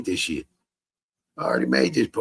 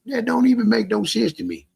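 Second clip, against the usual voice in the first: incredulous; 12 semitones higher than usual. Real, not acted. Disbelief at commenter.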